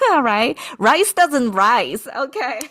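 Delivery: high, loud amused laughing delivery; tickled by a silly pun. Real, not acted. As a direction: chuckle through your own pun, drawing it out. Amused by own rice-rise pun.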